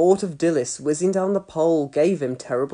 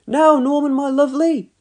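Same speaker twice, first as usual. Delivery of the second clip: high, louder doting mum voice; cooing mum; 9 semitones higher, 5 dB louder than usual. A narrator's reading. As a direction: coo it to your little boy. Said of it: "cooed Dilys".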